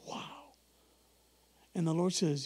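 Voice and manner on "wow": breathless whispered wow; awed whisper. Retold or imitated. Preacher seeing the camera, whispered awe. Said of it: "I'm like".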